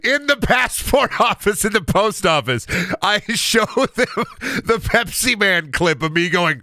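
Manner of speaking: can barely get it out for laughing; giggling retelling, voice up high. Real, not acted. Laughing through the story.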